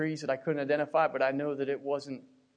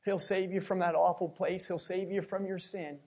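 ordinary delivery

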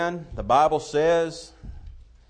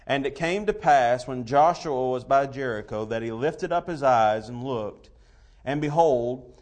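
scripture reading; 2 semitones higher, 4 dB louder than usual. Real, not acted. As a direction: read the King James verses with weight. Reads King James scripture aloud.

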